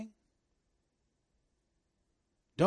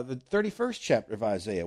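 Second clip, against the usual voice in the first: ordinary delivery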